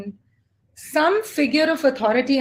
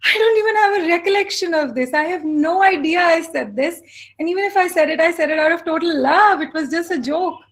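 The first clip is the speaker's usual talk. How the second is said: higher, lightly voiced mother brushing it off; imitating mother; 5 semitones higher, 3 dB louder than usual. Mother laughing off the old remark to her daughter, lightly voiced and higher. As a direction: play mom waving away the hurt, a touch higher.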